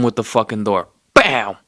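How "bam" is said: loud, high burst on a single word; explosive impact exclamation. Retold or imitated. Storyteller voicing the door whacking the mobster, explosive. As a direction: punch it out like a sudden hit.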